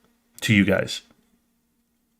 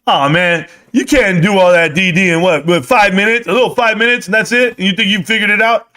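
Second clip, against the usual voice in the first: high, louder sneering doubter; mocking skeptic; 8 semitones higher, 4 dB louder than usual. Viewers doubting his research, high mocking skeptic voice. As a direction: ridicule the short research, pitched up. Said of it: "they'll go like this".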